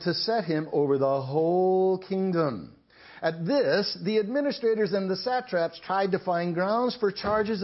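measured, projected Bible narration; scripture reading. Acted. Preacher reading Daniel to his congregation, scripture reading. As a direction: read the chapter out clearly to the whole room.

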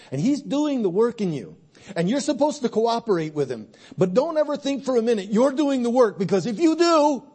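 loud, rising pulpit preaching; full-force preaching. Acted. Pastor urging the congregation to rekindle love, in a loud preaching voice. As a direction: preach it out and stir the crowd.

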